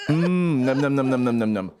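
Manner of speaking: playful greedy "num num" eating sounds; mock munching noises. Bears fan pretending to devour rivals' tears, playful munching. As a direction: gobble it up, dropping low.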